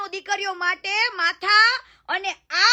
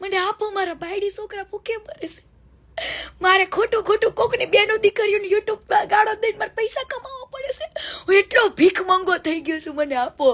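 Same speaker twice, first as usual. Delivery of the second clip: mock beggar's plea; 3 semitones higher than usual. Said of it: "વાટકો લઈને જા કે ભાઈ".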